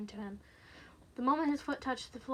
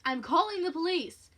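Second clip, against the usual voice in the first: loud, higher threat; forceful raised voice; defiant warning; 6 semitones higher, 10 dB louder than usual. Acted. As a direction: call it out to the intruder. Woman warns intruder loudly.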